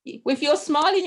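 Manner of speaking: ordinary talk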